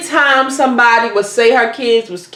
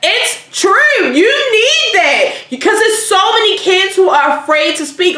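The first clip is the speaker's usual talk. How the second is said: ordinary talk, nothing marked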